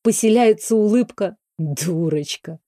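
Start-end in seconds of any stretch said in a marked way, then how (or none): 1.6-2.6 low, soft, slow affectionate chiding; man tenderly chiding the woman he loves; tender scolding. A narrator's reading. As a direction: scold her lovingly, hushed. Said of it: "ласково пожурил Кайлис".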